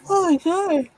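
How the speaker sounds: lightly dramatise your own dismay; exasperated self-quote. Retold or imitated. Quotes own exasperated reaction to yawning.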